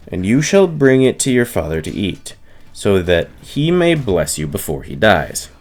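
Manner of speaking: usual speaking voice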